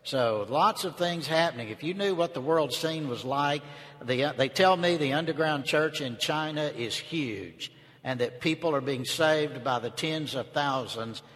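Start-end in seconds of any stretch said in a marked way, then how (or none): none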